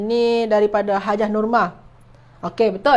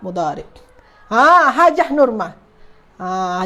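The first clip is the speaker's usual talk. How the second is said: ordinary talk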